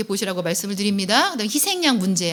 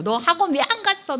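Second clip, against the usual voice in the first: scolding parent; 8 semitones higher than usual. Retold or imitated. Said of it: "그러지 마세요".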